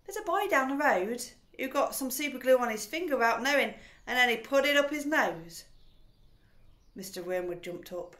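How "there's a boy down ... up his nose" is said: put-on innocent girl. A narrator's reading. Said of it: "Matilda said".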